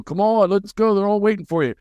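high, eager hurry-up call; retold impatient summons, pitched high; urging. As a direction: imitate someone rousing a person through a door. Retold dad banging on door, hurrying.

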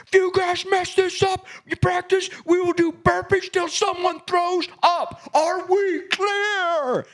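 high, straining re-enacted tirade, last words drawn out; yelling. Coach threatening his team with burpees, retold yelling. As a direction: imitate an unglued coach with veins sticking out. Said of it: "I'm yelling and the veins are sticking out".

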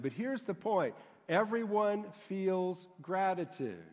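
ordinary delivery

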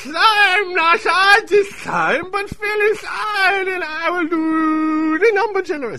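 high, loud shouting cartoon voice; shouted character voice. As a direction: bellow it in a silly high voice.

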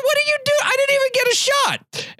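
very high, outraged squeal; hammed-up outrage at a stolen shot; retold furious protest. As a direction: let the indignation burst out high. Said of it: "oh, I was so mad".

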